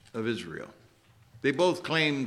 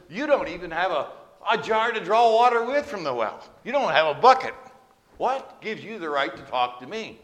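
higher and louder, pointed retort; making her point; 6 semitones higher, 6 dB louder than usual. Retold or imitated. Samaritan woman retorting to Jesus at the well, pointed. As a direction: push back hard at him. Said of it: "She kinda wanted to make her point clear".

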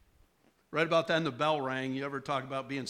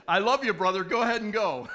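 loud, higher, laughing send-off; calling out affectionately; 5 semitones higher, 7 dB louder than usual. Real, not acted. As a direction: call after a departing friend, breaking into laughter. Calling to man leaving, laughing.

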